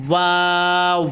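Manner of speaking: ওয়াও drawn out, closing to round lips; fully rounded lips. Shown as a demonstration. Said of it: "ঠোঁটটায় পুরো গোল করতে চেষ্টা করবেন".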